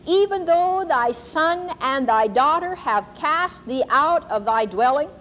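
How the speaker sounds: slow, solemn, rising prophecy cadence; prophetic reading. Shown as a demonstration. Preacher reads the prophecy to the congregation, slow and solemn. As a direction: intone it like a word from God. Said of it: "I'm going to read to you".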